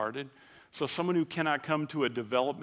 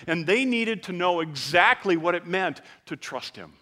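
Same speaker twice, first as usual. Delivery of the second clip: loud, raised preaching voice; preaching at full force; 6 semitones higher, 8 dB louder than usual. Acted. Pastor urging his congregation, loud full-force preaching. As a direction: drive it home to the congregation.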